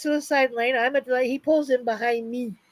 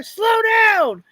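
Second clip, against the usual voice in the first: higher, louder barked order; cop's yell; 8 semitones higher, 4 dB louder than usual. Retold or imitated. Cop yelling at driver to slow down. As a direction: bark it like an angry cop.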